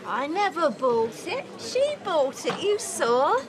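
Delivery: high-pitched tipsy denial; indignant protest. Acted. Drunk mother protests the accusation.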